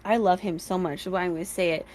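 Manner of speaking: ordinary delivery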